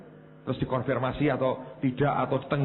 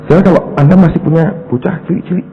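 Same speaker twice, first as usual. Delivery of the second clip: much louder, forceful appeal; preaching shout; 14 dB louder than usual. Real, not acted. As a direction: preach it full force to the crowd. Puncak khotbah. (berkhotbah dengan suara lantang)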